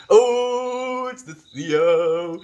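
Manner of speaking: high, loud, drawn-out welcome; excited greeting. Real, not acted. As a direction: greet a friend with a big, high "oh". Greets a friend joining stream.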